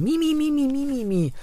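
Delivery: high, whining sing-song mimimi; whiny mimimi. Pretend. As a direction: whine like a self-pitying child. Whining about sore throat.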